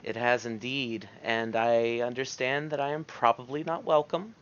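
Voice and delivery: a hint of a wary courtier; courtly elf character. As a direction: address a fey lord with careful respect. Plays Varys to Oberon.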